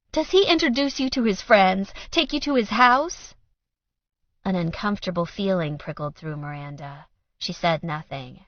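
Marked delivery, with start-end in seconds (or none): 0.1-3.3 higher, louder probing questions; pressing. A narrator's reading. Mother probing daughter, higher and louder. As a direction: pin her down.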